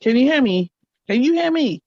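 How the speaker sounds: calling out. Real, not acted. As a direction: call out to be heard. Calling out on a frozen call.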